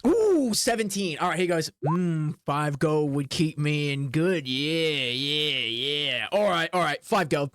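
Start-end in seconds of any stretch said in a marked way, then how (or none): 1.9-7.5 pondering guard. Acted. Guard accepts the bribe.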